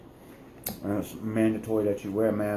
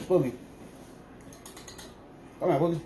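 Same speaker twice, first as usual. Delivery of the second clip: calling the cat; 7 semitones higher, faster than usual. Real, not acted. Owner calling her cat Boogie in a sing-song call. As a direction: coax the cat over in a lifted voice.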